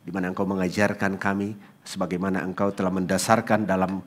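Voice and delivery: soft, quiet prayer voice; hushed pastor's prayer; closing prayer. Acted. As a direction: pray gently over the congregation.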